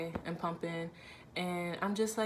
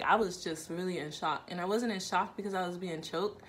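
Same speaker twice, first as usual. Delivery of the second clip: usual speaking voice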